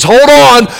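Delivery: a dad warning his son before the crash; urgent warning. Retold or imitated. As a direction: brace him with a sharp call. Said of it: "and he says".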